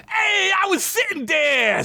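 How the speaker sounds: put-on high skeleton voice in New York accent. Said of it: "with a heavy adult New York accent".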